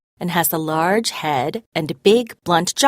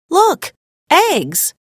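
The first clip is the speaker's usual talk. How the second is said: much higher, louder thrilled cry; excited exclamation; 11 semitones higher, 4 dB louder than usual. A narrator's reading. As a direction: burst out with delight at the eggs. Graham sees eggs laid.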